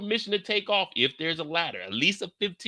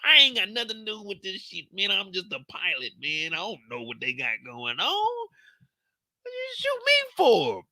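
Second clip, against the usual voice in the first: a hint of the higher pilot voice, whiny; pleading pilot; 6 semitones higher than usual. Pilot complaining to the shooters, lightly voiced whine. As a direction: plead that you're just the pilot.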